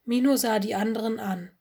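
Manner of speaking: usual speaking voice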